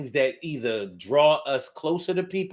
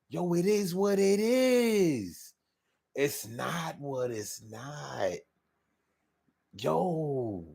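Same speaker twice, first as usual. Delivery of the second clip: long, dragged-out Philly drawl, fading softer; slow Philly accent; 6 dB quieter, much slower than usual. Shown as a demonstration. Host contrasting Philly with New York, slow drawling accent. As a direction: stretch every word like Philly. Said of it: "That's not how they talk in Philly".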